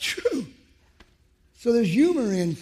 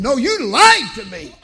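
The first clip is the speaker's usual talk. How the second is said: louder, slow, stressing lied; raised retold rebuke; 7 semitones higher, 11 dB louder than usual. Father repeating to son, louder rebuke. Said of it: "as if he didn't hear me".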